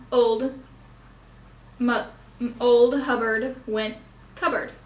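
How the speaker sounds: put-on just "old Hubbard went cupboard", slow and spaced; only stressed words. Shown as a demonstration. Summing up for the class, speaking only the stressed words. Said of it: "We only emphasize certain syllables".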